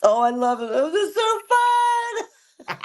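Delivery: high, singsong burst of joy; gush, voice soaring up to a squeal; delighted. Real, not acted. Singer thrilled a TV show took the song, delighted.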